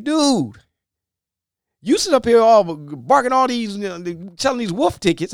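high, loud, heated calling out; angry Moses. Retold or imitated. Moses scolding Pharaoh for his threats, angry and high. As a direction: confront him hot with anger. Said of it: "Moses then got angry".

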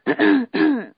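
throat-clear as if holding back tears; imitated throat-clearing. Retelling a man struggling to pray, imitated throat-clearing. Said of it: "like, clearing his throat and stuff".

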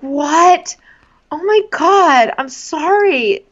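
high, drawn-out imitation of him; mock shock. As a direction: gasp out his apology, slow and overdone. Mocking his shocked apology.

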